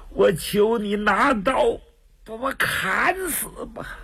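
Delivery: high, tearful plea breaking into a cry; pained begging. Acted. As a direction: beg for death through the pain. Wounded man begs to be killed.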